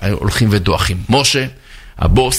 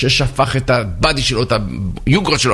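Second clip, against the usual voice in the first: normal talking voice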